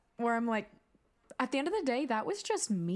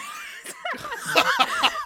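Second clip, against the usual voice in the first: loud high laugh; 9 dB louder than usual. Real, not acted. Cohost laughing at her own dream story.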